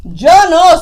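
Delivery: loud, emphatic rabbit refusal; Conejo refusing. Acted. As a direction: burst out with a loud no. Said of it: "said Conejo".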